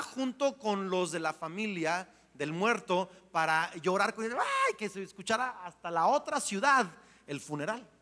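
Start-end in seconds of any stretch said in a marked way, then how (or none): 4.3-4.7 high, stretched-out wailing "ay"; mock mourner's wail. Preacher mimics hired mourners at funerals, high wail.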